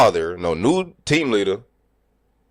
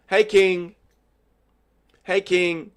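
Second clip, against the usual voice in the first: strangers greeting; 9 semitones higher, much slower than usual. Retold or imitated. Strangers greeting him at plant.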